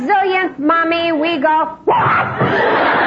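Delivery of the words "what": exasperated shout. Retold or imitated. Overloaded mother shouting at her pestering son. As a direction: snap at the kid in frustration.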